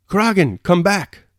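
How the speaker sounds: high, loud alarm call; shout. A narrator's reading. Dólokhov calling Kuragin back from a trap, shouting. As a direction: shout a desperate warning, pitched right up. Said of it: "shouted Dólokhov".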